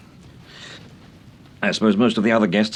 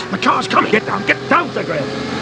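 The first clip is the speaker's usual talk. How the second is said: urgent warning shout; 11 semitones higher than usual. Acted. Temple warning Sir Graham of the oncoming car, shouting. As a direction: yell at them to take cover.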